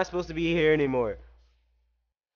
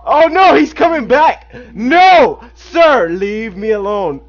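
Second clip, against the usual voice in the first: high, loud panicked pleading; yelling in panic as he chases; panicked fleeing; 8 semitones higher, 11 dB louder, slower than usual. Real, not acted. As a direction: beg him to back off in panic. Fleeing enemy in game.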